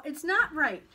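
put-on loud, high boyish refusal; emphatic child's protest; firm refusal. A narrator's reading. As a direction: shut the temptation down hard. Paul refuses again.

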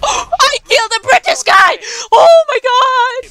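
high, loud triumphant shriek; a high screaming celebration; thrilled shout. Real, not acted. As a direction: scream with disbelieving joy. Thrilled kill of opponent.

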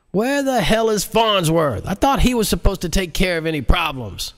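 very high exasperated president; furious president. Acted. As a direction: demand it in exasperation. President demands Farnsworth.

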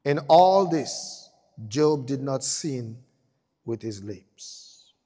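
solemn scripture reading. Acted. Preacher reading Job's verdict slowly and gravely. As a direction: let each word land slowly. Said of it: "solemn slow read".

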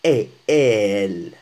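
"elle" with a long open eh, loud and slow; distinct open vowel. Shown as a demonstration. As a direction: draw out the eh, then "elle", falling. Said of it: "لا ينطقان، آه، بنفس الطريقة".

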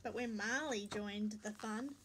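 plain reading voice

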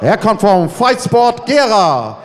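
rising, building announcer hype; lucha libre announcer. Acted. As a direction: build up to the name. Announcer builds to name call. (steigernde Ringsprecher-Ansage)

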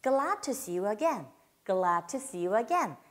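"glad to" run together, the d swallowed into to; linked glad to. Shown as a demonstration. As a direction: blend "glad to" into one slow sound. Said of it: "이렇게 발음하시면 됩니다".

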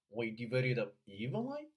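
"we divided up evenly" linked, silent p, stressed rising "evenly"; model for repetition. Shown as a demonstration. Coach leading three repeats, linked model reading. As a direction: run "we divided up" together, then lift "evenly". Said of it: "请跟读三遍".

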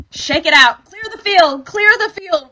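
loud, high-pitched call; energetic release. Shown as a demonstration. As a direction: call it out while shaking loose. Leading listeners in shaking out tension.

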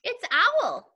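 mouse voice. Acted. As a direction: greet them as a perky storybook mouse. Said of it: "such an impressive mouth voice".

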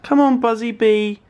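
nervous coaxing. Real, not acted. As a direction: sweet-talk the bee, scared. Coaxing the bee.

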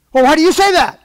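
student shouting a demand for reasons; exasperated shout. Retold or imitated. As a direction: shout the question in disbelief. Heated quoted back-and-forth argument.

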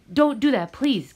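urgent plea. Acted. Gabriel begs the contractor not to fire him, urgent. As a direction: plead to keep the job.

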